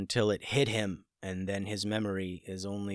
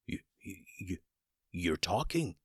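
breathless shocked stammer; whispered stammer from a wizard who has lost his breath; 5 dB quieter than usual. A narrator's reading. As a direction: trip over the words in shock. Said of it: "stammered Dooley".

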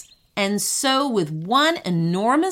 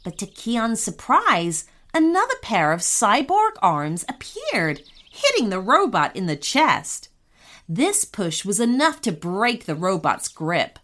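ordinary delivery, nothing marked

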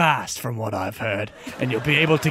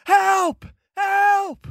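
screaming for help; much slower than usual. Acted. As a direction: yell for help like you're being robbed. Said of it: "someone screaming".

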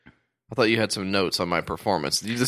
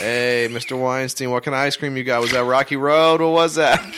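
higher, louder pushy reporter; pestering paparazzo; 4 semitones higher, 6 dB louder than usual. Retold or imitated. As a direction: hound him with questions. Voices pestering TMZ guy.

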